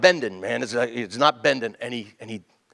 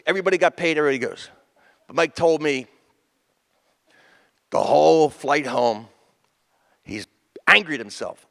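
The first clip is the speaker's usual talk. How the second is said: ordinary talk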